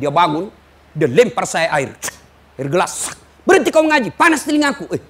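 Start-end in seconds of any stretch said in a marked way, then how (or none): 3.5-4.9 high, loud angry outburst; angry dying man. Retold or imitated. Dying patient yelling at the ustadz to stop reciting, angry.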